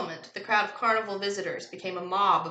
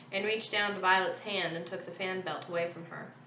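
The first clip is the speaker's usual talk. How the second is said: ordinary delivery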